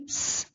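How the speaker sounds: س as a thin hissed sss; whistling s. Shown as a demonstration. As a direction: hold س as a sharp whistling hiss. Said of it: "سیٹی کی آواز آتی ہے". (س باریک، سیٹی جیسی آواز میں)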